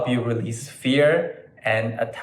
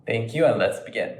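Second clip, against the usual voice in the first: ordinary talk, nothing marked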